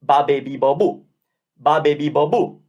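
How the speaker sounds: quick run of B syllables with open vowels; Italian syllable drill. Shown as a demonstration. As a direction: rattle them off like an Italian. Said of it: "Already sounds a little bit crazy Italian".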